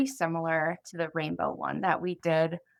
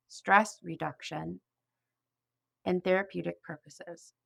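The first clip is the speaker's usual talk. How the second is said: guided imagery; 4 dB quieter than usual. Acted. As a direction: ease the listener gently into relaxation, pausing between phrases. Leads guided imagery script.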